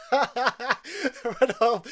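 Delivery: a laughing mock of his line, slow; a laughing take on his delivery; imitating Bruce Campbell. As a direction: quote the hero while cracking up. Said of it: "the way he says that shit".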